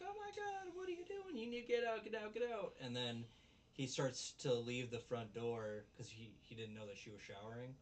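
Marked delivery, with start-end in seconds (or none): 0.0-2.7 put-on much higher shrieking girl voice; mock scream. Recapping anime, girl screaming at intruder in mock panic. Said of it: "she starts screaming".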